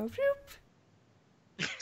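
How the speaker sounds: high squeaky zombie "Whoop"; little zombie voice. Acted. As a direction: pitch way up for a tiny zombie. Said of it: "the little zombies go".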